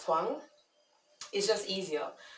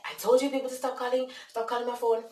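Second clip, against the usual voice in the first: higher, louder irritated protest; upset; 8 semitones higher, 5 dB louder than usual. Retold or imitated. Jamaican man to a repeat caller, imitated upset. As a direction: imitate a fed-up man on the phone. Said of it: "he was just upset".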